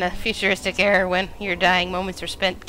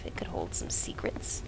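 softer, lower spooky whisper; 13 semitones lower, 9 dB quieter than usual. Pretend. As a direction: hush it like a secret. Creeps into an ominous cave.

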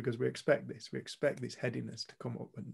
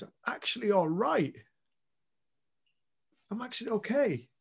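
higher, wondering quote of someone's discovery; imitated amazed realisation; 8 semitones higher, 4 dB louder than usual. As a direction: voice lifting high in dawning relief. Quoting a girl's amazed realisation.

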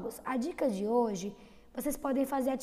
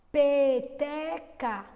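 Peteca stretched slowly, louder; drawn-out demo word; 5 dB louder, much slower than usual. Shown as a demonstration. Teacher stretching Peteca so pupils hear the first sound. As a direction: drag out Peteca syllable by syllable. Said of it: "Peteca começou com F?".

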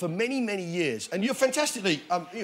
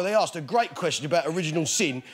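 normal talking voice

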